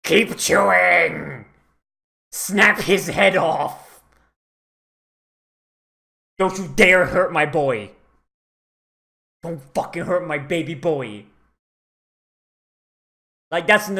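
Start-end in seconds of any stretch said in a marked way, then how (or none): 0.0-4.0 louder, slow egging-on; eager slow cheering. Real, not acted. Cheering a creature on. 6.4-11.3 protective yell. Real, not acted. Protecting the baby boy character.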